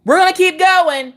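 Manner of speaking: high, pushed-up exclamation; mock-heroic high holler; rallying cry. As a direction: call it out high like a battle cry. Acted defiant rallying cry.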